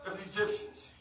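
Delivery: loud, rising sermon, shouted climaxes; whooping Sunday preacher, full voice; preaching at full force. Acted.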